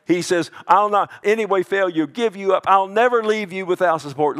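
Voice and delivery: fast, loud and high; preaching at full force. Real, not acted. Pastor thundering God's promise, full preaching force. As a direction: drive it home hard.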